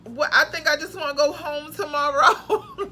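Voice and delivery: half-laughing through tears about the trip; tearful doubt. Retold or imitated. Alone in the room after the drive, teary laughing doubt. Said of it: "I was crying like".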